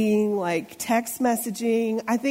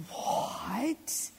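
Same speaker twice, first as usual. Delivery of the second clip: drawn-out, rising, hushed disbelief; soft, stretched mock bewilderment; baffled reaction; 10 dB quieter than usual. Baffled parent reacting to kids' sayings.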